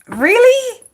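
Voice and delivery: high, incredulous "really"; surprised. Real, not acted. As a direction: disbelief at the pick. Hermes chosen for the Hermit.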